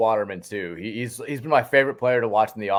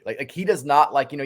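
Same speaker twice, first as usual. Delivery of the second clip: normal talking voice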